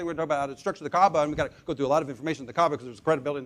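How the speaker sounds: rattled off fast, no pauses; rushing. Shown as a demonstration. Mocking a rushed presenter, fast and breathless. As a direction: race through it like a nervous presenter.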